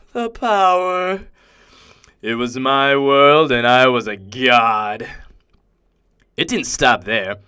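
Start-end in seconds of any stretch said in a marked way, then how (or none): 0.1-1.2 high, slow, drawn-out relish; rapturous, lingering gloat; exulting in power. Acted. Revels in power over insects. 2.2-5.1 slow, loud, grandiose declaration; weighty, self-glorifying gloat; megalomaniac boast. Acted. Boasts of godlike power.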